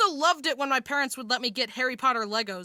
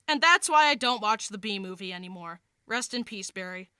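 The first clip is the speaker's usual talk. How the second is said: ordinary talk, nothing marked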